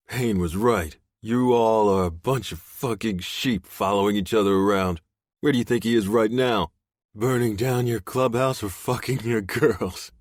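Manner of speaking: taunting captive. Acted. Voiced captive taunting the bikers.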